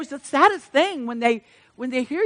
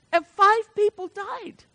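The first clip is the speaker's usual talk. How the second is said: choked, slow and higher, close to tears; voice breaking; 10 semitones higher, slower than usual. Real, not acted. Preacher telling a painful loss to the congregation, voice breaking. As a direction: hold back tears as you say it. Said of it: "I can never tell it without crying".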